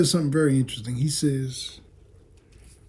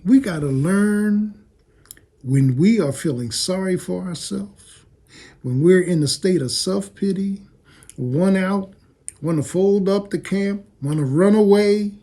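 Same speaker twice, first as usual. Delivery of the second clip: preaching loud; 6 semitones higher, 6 dB louder than usual. Real, not acted. Preacher building to full force.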